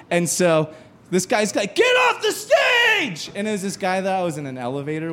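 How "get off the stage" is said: very high, slow shouted heckle; heckler yell. Retold or imitated. Heckler to the bombing comic, high angry yell. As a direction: boo him off from the crowd.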